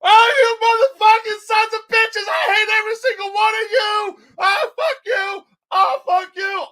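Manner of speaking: high, loud tearful screaming tantrum; screaming rage. Retold or imitated. Storyteller mimics friend cursing the food fighters, screaming. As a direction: imitate a friend screaming curses at everyone. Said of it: "He starts screaming".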